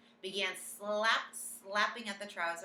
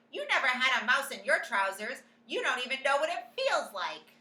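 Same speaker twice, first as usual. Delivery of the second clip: shouting back; 7 semitones higher, 11 dB louder than usual. A narrator's reading.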